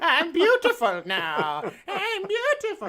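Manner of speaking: high, slow, giddy laughing voice; deluded hag. Retold or imitated. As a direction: play her blissfully unaware, laughing. Bloodied hag walking through town.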